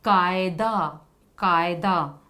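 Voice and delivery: teacher's clear repeat; model word. Shown as a demonstration. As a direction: slowly model कायदा. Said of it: "ख का प्रयोग".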